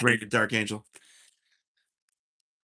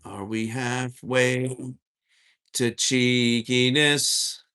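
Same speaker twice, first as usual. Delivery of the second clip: very slow, teasing question; slow drawn-out question; much slower than usual. Real, not acted. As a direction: stretch each word out teasingly. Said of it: "repeating spirit's word slowly".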